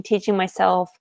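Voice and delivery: ordinary delivery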